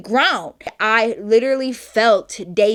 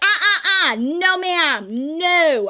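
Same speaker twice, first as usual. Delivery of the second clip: high-pitched, emphatic telling-off; sassy scolding refusal; 6 semitones higher, slower than usual. Retold or imitated. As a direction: shut her down with repeated sharp refusals. Retold scolding of the twerking woman.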